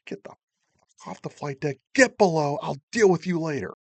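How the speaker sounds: mock chief barking an order; chief's order. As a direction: order him off the deck. Chief orders sailor off deck.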